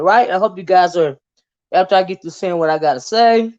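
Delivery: normal talking voice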